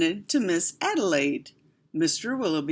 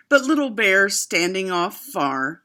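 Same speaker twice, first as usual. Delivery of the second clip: ordinary delivery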